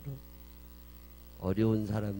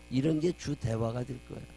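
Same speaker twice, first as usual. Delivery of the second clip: ordinary talk, nothing marked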